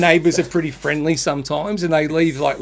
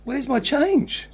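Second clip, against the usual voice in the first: puzzled Buddhist; 10 semitones higher, slower than usual. Acted. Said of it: "He goes".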